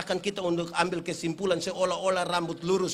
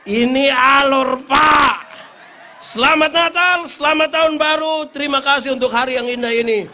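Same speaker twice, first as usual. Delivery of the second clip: rousing closing blessing; 6 semitones higher, 14 dB louder than usual. Real, not acted. Preacher closing Christmas sermon to the congregation, full-force shouted blessing. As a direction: send the church off at full force.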